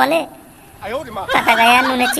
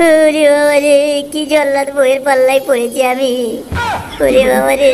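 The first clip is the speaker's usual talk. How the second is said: mock groaning. Husband moaning after beating.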